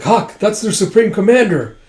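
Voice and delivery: high, loud acted call; retold battlefield warning; urgent warning. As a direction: warn a comrade urgently. Calling warning to Huck.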